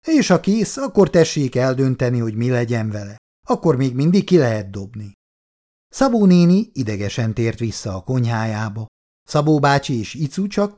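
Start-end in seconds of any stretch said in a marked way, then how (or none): none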